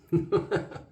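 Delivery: bright burst of laughter; quick amused chuckle; short laugh. Real, not acted. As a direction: laugh at yourself. Laughing at being a Yam Yam.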